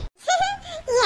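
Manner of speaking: short, high scoffing laugh. Acted. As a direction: laugh it off in disbelief. Scoffs at sister's warning. (ஏளனச் சிரிப்பு)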